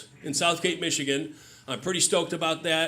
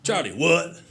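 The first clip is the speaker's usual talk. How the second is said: imitating hard-of-hearing dad; 3 semitones higher, 3 dB louder, slower than usual. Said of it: "I'll just go".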